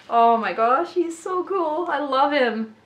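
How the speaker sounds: excited fangirl gushing; really excited. Real, not acted. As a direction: gush over a new treasure. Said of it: "I'm really excited".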